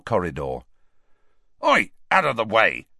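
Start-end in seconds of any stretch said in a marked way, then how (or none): 1.6-2.9 curt, rough barked order. Acted. Ron at a small girl in the corridor, barking. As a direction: bark at someone blocking you. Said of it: "Ron barked at a small girl".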